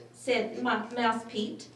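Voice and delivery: ordinary delivery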